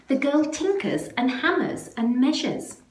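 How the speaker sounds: plain reading voice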